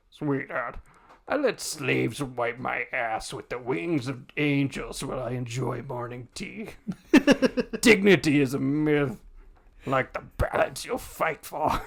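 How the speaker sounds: lazy, slow fat glutton voice; fat guy voice. Acted. Sloth sneering at Fury in a fat guy voice. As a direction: drawl it like a bloated lord. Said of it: "I kinda gotta try and do a fat guy voice".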